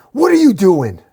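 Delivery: loud, high outraged question; screamed demand. A narrator's reading. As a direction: shout it in disbelief. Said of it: "Batman screams".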